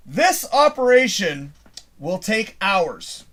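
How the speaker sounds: slow, drawn-out reading of the screen message; slow computer readout. Retold or imitated. Reading the laptop's warning to the viewers, slow and drawn out. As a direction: spell out the message slowly, like a machine.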